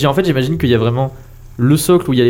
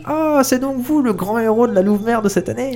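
put-on old woman voice; 7 semitones higher than usual. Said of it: "elle parle un petit peu doucement".

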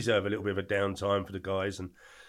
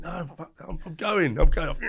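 rising, louder, urgent I'm going; mock panicked yell at a mate; panicked refusal; 6 semitones higher, 6 dB louder than usual. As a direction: blurt it out in a panic.

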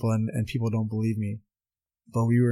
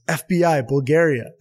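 louder, higher, a proud Bulgarian boast; proud announcement; 4 semitones higher, 5 dB louder than usual. Retold or imitated. Hristo flashing his badge to the cop, proudly announced. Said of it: "and he goes".

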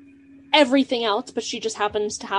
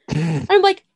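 normal talking voice

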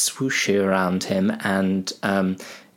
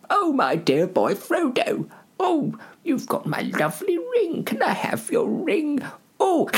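put-on a much higher, creaky old hobbit voice turning greedy; old Bilbo. Bilbo greeting Frodo then craving the ring, high old voice. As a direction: coo then hiss for the ring.

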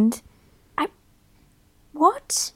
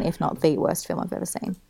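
normal talking voice

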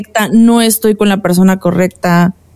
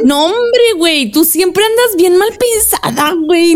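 teasing; 8 semitones higher than usual. Real, not acted. Teasing a friend as suspicious.

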